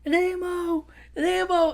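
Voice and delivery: father finding his lost son, rising to high cries; father's joyful cry. Retold or imitated. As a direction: call out, unsure then overjoyed. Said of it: "He's like".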